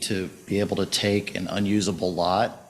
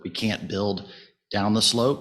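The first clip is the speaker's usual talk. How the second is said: ordinary talk, nothing marked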